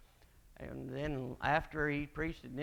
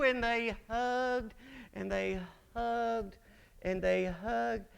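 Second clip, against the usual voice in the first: slow, stretched words, starting high and easing down; drawn-out repetition; 7 semitones higher, slower than usual. Real, not acted. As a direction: stretch each hug out as if it never ends. Stretches the repeated endless hugging.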